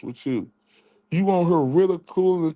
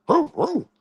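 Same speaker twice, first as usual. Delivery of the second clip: high, yapping mock dog bark; 11 semitones higher than usual. Streamer mocking a rival by playing him as a dog, yapping. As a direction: yap like a little dog.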